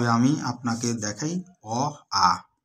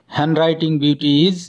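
slogan; 6 dB louder, much slower than usual. Acted. Closing slogan of the lesson.

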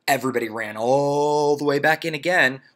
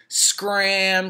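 1 mock scram; 9 semitones higher, 5 dB louder than usual. Kids chase Ron off.

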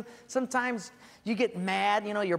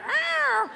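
high, loud comic screech; a cat's high, loud hammed-up screech at the dog; 6 dB louder than usual. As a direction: yelp like a bitten cat. Dog bites the cat.

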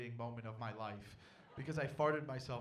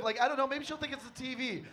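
ordinary talk, nothing marked

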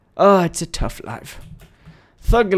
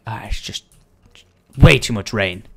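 usual speaking voice

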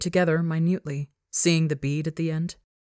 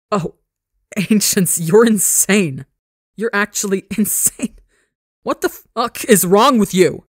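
laughing in disbelief, louder and higher; incredulous cackling; 4 semitones higher, 7 dB louder than usual. Acted. Son laughing at his father's insane offer, loud disbelief. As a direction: cackle at the absurdity, voice climbing. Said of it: "he tips his head back and cackles".

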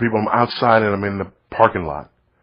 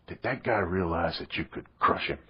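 soft, low question whispered in the dark; hushed child's question; 5 semitones lower, 14 dB quieter than usual. Retold or imitated. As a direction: ask it softly so as not to break the silence. Son asks quietly in the dark.

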